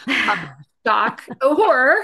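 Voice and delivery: hammed-up gasp of shock, high and loud; mock horror. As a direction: play it as fake scandalised horror. Mock outrage at au pair failure.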